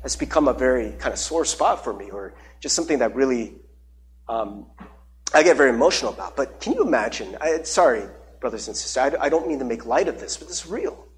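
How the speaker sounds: holding back tears as he speaks; emotional. Real, not acted. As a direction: voice sinking low and quiet with real emotion. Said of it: "I just get really emotional".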